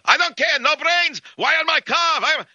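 very high-pitched mock Russian yelling; screaming crazed Russian. Crazed Russian berates the man parked against his bumper, screaming. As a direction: rant in a frantic, high imitation of an angry Russian. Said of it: "he's screaming and yelling".

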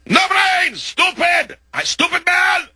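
very high-pitched ranting of an enraged Russian; mock Russian screaming. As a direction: imitate a livid Russian shrieking in broken English, without extra volume. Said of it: "he's screaming and yelling".

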